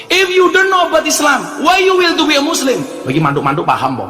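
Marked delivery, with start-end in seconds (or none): 0.1-2.8 raised voice demanding why; mock incredulous retort. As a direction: throw the question back, amazed. Preacher retorts to foreigner.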